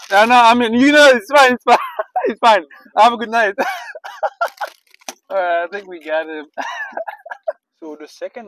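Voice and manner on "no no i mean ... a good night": louder, higher, breaking into laughs; laughing it off. Real, not acted. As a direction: giggle through the apology to the cashier. Prank revealed, laughing it off.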